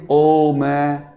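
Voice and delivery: "ओ मैं" very slow, both sounds held as separate syllables; wrong pronunciation. Shown as a demonstration. Teacher showing students what to avoid, "ओ मैं" drawn out in two syllables. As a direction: stretch "ओ", then a full open "मैं". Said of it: "इसका उच्चारण ये नहीं करेंगे".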